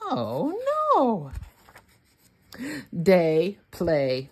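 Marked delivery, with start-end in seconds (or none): none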